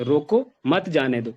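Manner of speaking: "रोको", a clear break, then "मत जाने दो"; full stop after रोको. Shown as a demonstration. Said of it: "रोको के बाद फुल स्टॉप लगाया". ("रोको" के बाद पूरा ठहराव)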